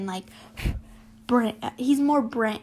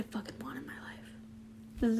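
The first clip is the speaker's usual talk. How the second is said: breathy whisper, much softer; whispered aside; 11 dB quieter, slower than usual. Real, not acted. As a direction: say it under your breath. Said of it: "whispered aside".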